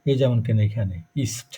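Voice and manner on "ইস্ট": "ইস্ট" with a hissed S before T; S within syllable. Shown as a demonstration. Teacher answers student about S, unvoiced S sound. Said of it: "এখানে এটা দেখেন ছয়ের মতন".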